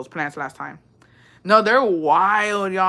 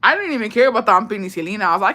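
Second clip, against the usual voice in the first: usual speaking voice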